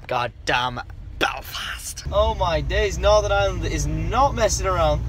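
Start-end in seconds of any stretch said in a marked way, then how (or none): 0.1-2.0 slow, unvoiced whisper. Real, not acted. Vlogger listing evening plans, slow whispered aside. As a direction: lean in and whisper it.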